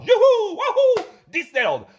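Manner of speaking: high loud whoops like a fairground ride; mock thrill. Pills slide down to the customer with a loud whoop. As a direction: cheer like a kid on a slide.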